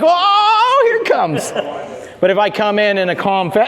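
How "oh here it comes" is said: high, excited dog voice, hammed-up; amped-up dog. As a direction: whoop it like a dog bracing for the fun. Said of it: "he already postured up. He's like".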